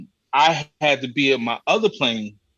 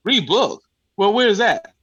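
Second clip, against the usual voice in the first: high, louder outraged echo; indignant disbelief; 6 semitones higher, 4 dB louder than usual. Retold or imitated. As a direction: throw the word back in disbelief. Outraged at the rebooking.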